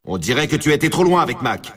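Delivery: usual speaking voice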